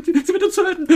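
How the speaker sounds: high laughing mock panic; laughing mock fear. Mother jokes the AI will kill them, laughing high. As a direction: giggle through a fake doom line.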